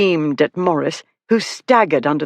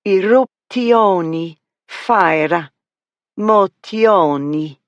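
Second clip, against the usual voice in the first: slow, drawn-out mock Italian accent with extra end vowels; 3 semitones higher, much slower than usual. Girl repeating her favourite words, in a mock Italian accent. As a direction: savour each word Italian style, eruptioni. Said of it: "That's her extremely Italian accent".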